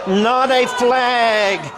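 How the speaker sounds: ordinary talk, nothing marked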